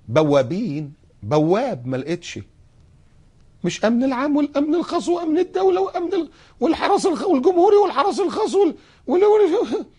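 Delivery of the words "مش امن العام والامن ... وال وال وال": mocking list. Mocking endless guard list.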